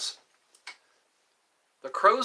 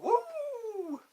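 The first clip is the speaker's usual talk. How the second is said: high whoop; 5 dB quieter than usual. Pretend. Whooping after the [name] line.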